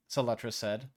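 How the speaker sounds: plain reading voice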